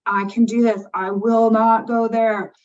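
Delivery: insisting she won't give in to illness; determined self-talk. Retold or imitated. Woman quoting her own stubborn vow, slow and firm. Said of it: "people like me who are like".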